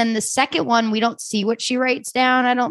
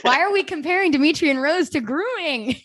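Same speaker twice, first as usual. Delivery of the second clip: higher-pitched incredulous objection; mock protest; 6 semitones higher than usual. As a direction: protest in playful disbelief. Acting out own protest at comparison.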